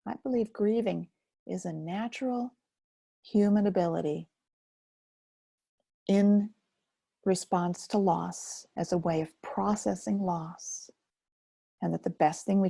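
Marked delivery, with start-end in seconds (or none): none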